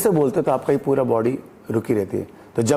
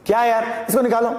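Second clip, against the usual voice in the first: high, offhand irritation; dismissive to a junior; 7 semitones higher than usual. Shown as a demonstration. Telling a junior to throw the man out, irritated. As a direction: brush off a junior with annoyance. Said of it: "कोई मुझसे छोटा होगा तो".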